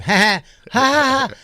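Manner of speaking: high, loud stagey ha-ha; fake kid laugh. Kid stealing a TV laugh, high and loud. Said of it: "kids have".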